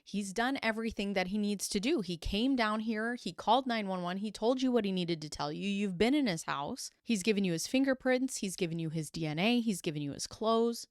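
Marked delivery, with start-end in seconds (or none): none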